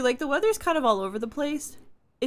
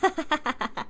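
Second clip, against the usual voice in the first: laughing; 6 semitones higher than usual. Real, not acted. Cold weather complaint, laughing.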